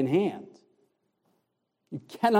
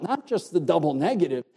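ordinary talk, nothing marked

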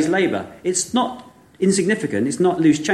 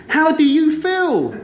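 high, slow interviewer voice; mock reporter with microphone; reporter's question; 11 semitones higher, slower than usual. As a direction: ask it like an eager TV reporter. Reporter question to forgiven servant.

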